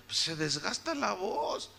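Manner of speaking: ordinary delivery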